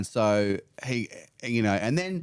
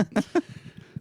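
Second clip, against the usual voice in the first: teary laugh. Real, not acted. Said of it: "This bit sets me off".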